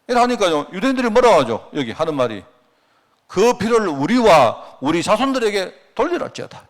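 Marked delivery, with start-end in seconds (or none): 3.3-6.7 raised, slow mock crowd call; crowd's defiant cry. Preacher voicing the crowd before Pilate, a slow raised cry. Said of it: "이렇게 말합니다".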